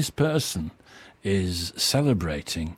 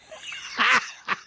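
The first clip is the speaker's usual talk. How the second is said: breathy laugh; 13 semitones higher than usual. Real, not acted. Laughs at quiz answer.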